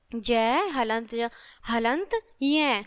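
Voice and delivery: slow, part by part, "जय हलंत ज-" then "हलंत य बराबर ज्ञ"; teacher slowly sounding out how ज्ञ is built; slow spelling out. Shown as a demonstration. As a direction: build the letter ज्ञ step by step for the class, slowly. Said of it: "तो ज्ञ से ज्ञान और यज्ञ".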